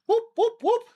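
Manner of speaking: high, bright whooping cheer; excited whoop. Real, not acted. Streamer whooping to chat after squats, excited.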